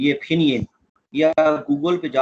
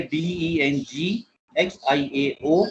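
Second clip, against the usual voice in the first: slow spelling; much slower than usual. Shown as a demonstration. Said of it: "spelling मैंने बोले".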